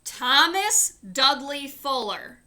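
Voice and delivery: loud, slow full-name scolding; angry father's shout. Acted. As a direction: catch him out from the shadows. Father catches son again.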